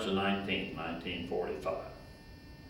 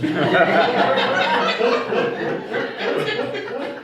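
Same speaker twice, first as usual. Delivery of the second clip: loud high wheezing laugh; big laugh; 12 semitones higher, 12 dB louder than usual. Real, not acted. Old man laughing hard at his own story.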